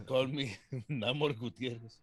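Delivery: put-on slow, joking Spanish-accented introduction. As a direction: give the name a Spanish accent, laughing midway. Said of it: "I had to say it with the accent".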